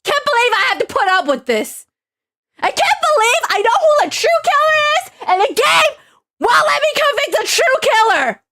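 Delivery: high-pitched, outraged squealing rant; mad rant. Real, not acted. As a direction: let outrage push the voice way up. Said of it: "I'm so mad!".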